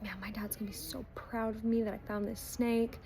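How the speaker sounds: put-on hushed, breathless whisper of a thrilled kid; excited whisper. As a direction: whisper it to yourself, bursting with pride. Excited boy's private thought.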